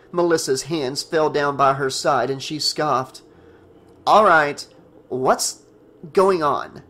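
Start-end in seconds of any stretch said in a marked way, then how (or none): 4.1-6.8 loud opening, broken by pauses; demanding. A narrator's reading. Melissa scoffs at Nick and Tina, demanding. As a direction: demand an answer, punching the first words. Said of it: "she demanded".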